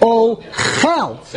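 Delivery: "Ochel" broken as o... KHEL, end stressed; stress on last syllable. Shown as a demonstration. Teacher showing the verb form, "Ochel" stressed on the end. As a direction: hold back, then hit the "chel" of "Ochel". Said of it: "You put the emphasis on the end".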